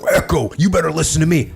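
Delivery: acted aggressive order to listen up; mock outward aggression. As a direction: play at bossing him around forcefully. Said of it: "it's not an external aggression".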